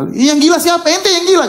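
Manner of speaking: high-pitched pretend indignation; mock angry retort. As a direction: play at throwing the insult straight back, pitch jumping up. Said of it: "Nabi tidak marah-marah".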